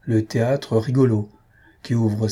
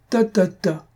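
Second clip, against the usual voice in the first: high, grumpy brush-off; gruff dismissal; 9 semitones higher, slower than usual. A narrator's reading. Gruff husband waves away his wife's defence, dismissive. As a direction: cut them off gruffly, pitched up. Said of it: "répondit notre bourru".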